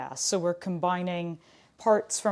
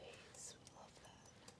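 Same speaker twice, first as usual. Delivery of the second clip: whispering; 33 dB quieter, much slower than usual. Real, not acted. Wry aside about technical difficulties.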